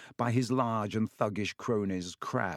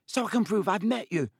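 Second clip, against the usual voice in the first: put-on high, eager boy voice; eager schoolboy pressing his case; 10 semitones higher, 3 dB louder than usual. A narrator's reading. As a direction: bubble over with keenness. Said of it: "said Colin Creevey eagerly".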